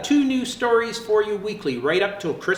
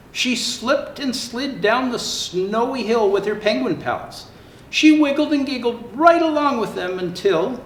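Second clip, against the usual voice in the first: lively storybook narration; reading to children; 3 semitones higher than usual. Acted. Santa reading a zoo story to children, lively. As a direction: tell it brightly for little ones. Reads Fiona story aloud.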